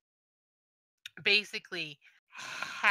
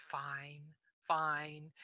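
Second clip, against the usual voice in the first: muttered; 2 semitones lower, 9 dB quieter than usual. Acted. Guard giving in to the argument, muttering. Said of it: "he sort of mutters".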